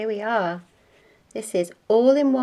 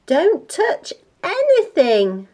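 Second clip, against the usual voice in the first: high, loud, slow warning; alarmed mother's command; 9 semitones higher, 5 dB louder than usual. Acted. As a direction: spell out the order to a naughty child.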